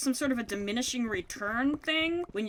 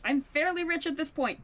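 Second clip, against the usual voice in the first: ordinary delivery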